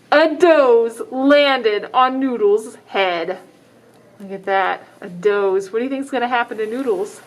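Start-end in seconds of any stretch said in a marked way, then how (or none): none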